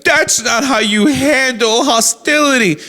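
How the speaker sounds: a lightly voiced, high, slow scold; mocking prissy critic. Mocking critics of his approach.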